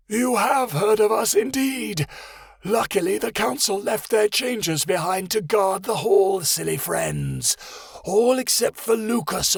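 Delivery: high, slow theatrical monster voice; gloating lizard voice. Acted. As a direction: introduce yourself like a smug beast. Monitor lizard's voice.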